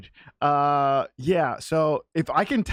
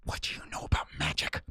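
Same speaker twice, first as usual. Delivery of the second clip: whisper; 14 semitones lower, 8 dB quieter than usual. Acted. Suspicious in-character demand.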